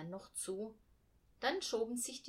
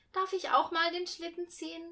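put-on little girl asking; 10 semitones higher, 9 dB louder than usual. A narrator's reading. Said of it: "fragte Hannah ihren Bruder".

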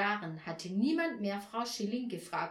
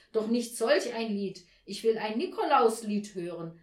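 loud, indignant Nikolaus; raised-voice objection; exclaimed demand; 7 dB louder than usual. A narrator's reading. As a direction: insist loudly on what you want. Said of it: "rief der Nikolaus".

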